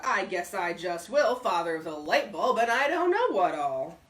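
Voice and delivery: a hint of a loud, boastful old man; gruff old braggart. A narrator's reading. Bullard boasting loudly.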